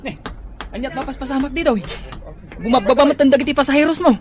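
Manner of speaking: high, loud and fast; fast alarmed yell at the brawl; alarmed shout. Acted. Son alarmed at fight.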